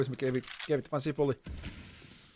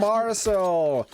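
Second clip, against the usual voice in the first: excited shout; 7 semitones higher, 8 dB louder than usual. Real, not acted. Caster shouts player's name.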